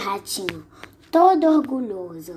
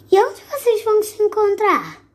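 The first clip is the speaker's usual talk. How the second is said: high, eager snake voice; the snake pressing the mouse for details, high and eager; curious snake; 11 semitones higher, 4 dB louder than usual. Acted. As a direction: lean in like a nosy snake. Snake asks, much higher.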